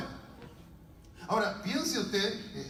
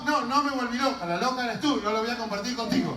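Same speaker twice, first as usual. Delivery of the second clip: higher, louder mock indignation; defiant retort; 5 semitones higher, 5 dB louder than usual. Woman answering her sibling, acted defiance. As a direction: throw the accusation back.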